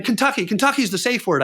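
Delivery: Kentucky said high but steady, proposing it; scared man naming the safe word Kentucky; urgent suggestion. Retold or imitated. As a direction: offer the safe word, worried. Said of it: "like this".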